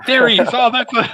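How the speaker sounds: high, loud delighted exclamation; excited recognition. Retold or imitated. Watching the film, spotting his friend with delight. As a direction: spot him on screen and light up.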